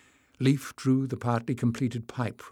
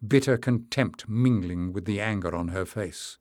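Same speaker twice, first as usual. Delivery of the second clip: plain reading voice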